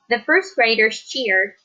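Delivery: ordinary delivery, nothing marked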